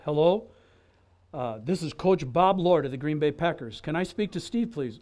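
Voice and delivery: football coach calls the party house, deep and resonant; deep voice of Bob Lord. Retold or imitated. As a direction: imitate the coach calling down the phone. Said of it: "this deep, resonant bass voice".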